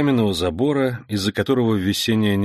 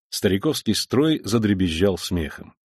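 ordinary delivery, nothing marked

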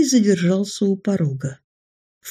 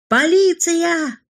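higher, slow drawn-out cry of police; drawn-out alarm shout; 11 semitones higher, slower than usual. Acted. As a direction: call it out long and high like a warning across the street. Alarm shout in street chase.

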